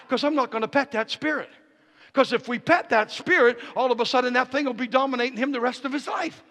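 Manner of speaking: loud, high declaration building to a shout; full-force preaching. Real, not acted. Preacher rousing the church to claim victory, shouted preaching. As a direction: preach it up to a roaring climax.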